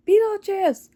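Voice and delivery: mock yell of the name. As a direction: call out his name like an angry wife. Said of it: "she's like".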